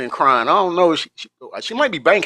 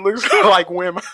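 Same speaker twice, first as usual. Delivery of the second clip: laughing retelling; 6 semitones higher, 3 dB louder than usual. Retold or imitated. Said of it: "She said".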